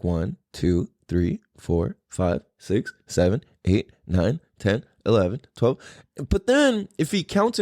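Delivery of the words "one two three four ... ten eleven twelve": low, evenly spaced count, each number rising; imitating a child counting alone; lightly imitated counting toddler. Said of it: "hear him counting in the other room".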